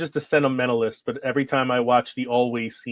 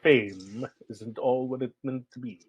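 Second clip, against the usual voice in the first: softer, slow Snape drawl; 6 dB quieter, slower than usual. Retold or imitated. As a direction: stretch each word out quietly and slowly. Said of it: "Where he drolls outwards".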